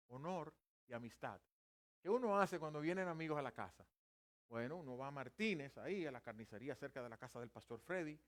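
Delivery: normal talking voice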